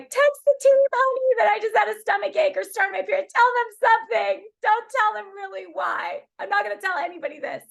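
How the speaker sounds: upset plea. Retold or imitated. Asking her husband to text the team, high tearful plea. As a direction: beg him to cover for her, near tears. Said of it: "I'm like".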